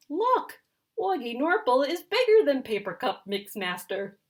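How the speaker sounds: high and loud, bursting with news; high, loud eager exclaim; excited announcement. Acted. Louie eagerly announces cat grew.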